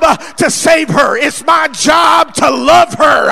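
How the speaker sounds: loud preacher building to a shout; full-force preaching. Real, not acted.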